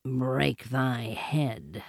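low, soft, very slow panther voice; hushed, drawn-out low threat; quiet. A narrator's reading. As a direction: murmur it slowly as the punchline. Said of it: "said Bagheera quietly".

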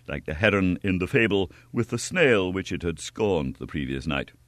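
plain reading voice

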